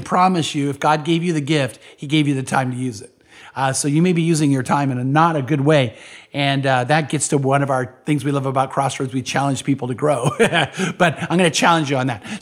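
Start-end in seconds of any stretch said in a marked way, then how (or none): none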